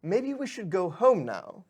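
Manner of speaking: high, humoring little servant, slowing down; patient pixie. Acted. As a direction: coax your master home gently. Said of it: "he's like".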